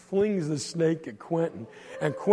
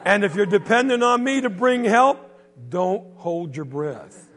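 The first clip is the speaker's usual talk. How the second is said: mocking laugh; 5 semitones higher, 9 dB louder than usual. Silas mocks Quentin.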